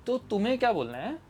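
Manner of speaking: usual speaking voice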